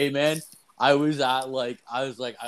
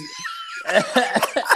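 loud high laugh; 3 dB louder than usual. Real, not acted. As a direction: burst of high-pitched laughter. Laughing at his own story.

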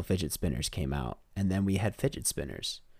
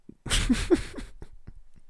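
airy laugh. Real, not acted. Laughing at own joke.

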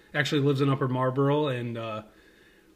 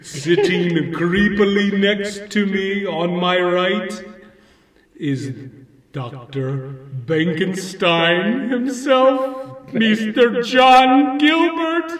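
slow, loud horror host; spooky vampire host; 6 semitones higher, 8 dB louder, much slower than usual. Acted. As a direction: introduce him like a ghoul. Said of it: "Count Brian Garnula".

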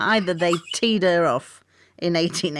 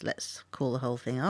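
normal talking voice

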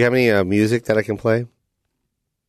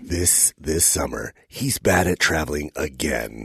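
movie trailer voice; 6 semitones lower, 4 dB quieter than usual. Acted. Doing trailer voice for Liam.

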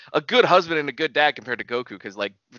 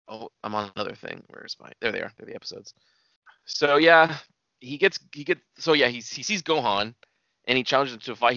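ordinary talk

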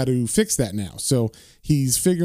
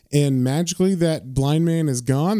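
ordinary delivery

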